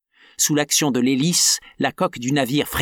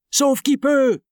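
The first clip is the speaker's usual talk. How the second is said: every man for himself, yelled; panicked shout; 12 semitones higher, slower than usual. Acted. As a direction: sailors yelling in panic. Crew panicking.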